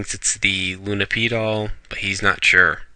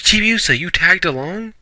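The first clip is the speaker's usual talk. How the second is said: much higher, mock surprised; surprised girl; 9 semitones higher than usual. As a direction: pitch it up like a startled girl. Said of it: "Usagi goes".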